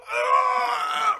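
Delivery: put-on sudden high yelp of a kid knocked down; mock pain yelp. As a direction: yelp high like you just took a kick. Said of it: "and they'd go".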